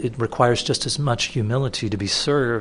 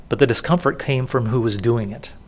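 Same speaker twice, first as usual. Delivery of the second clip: ordinary delivery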